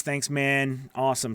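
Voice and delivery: ordinary delivery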